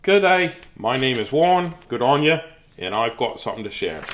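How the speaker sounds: badly faked Australian twang; weird mock-Australian accent. Reading Warren's greeting to viewers in a weird mock-Australian accent. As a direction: have a hammy go at an Australian accent. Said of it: "I'll do some sort of weird accent".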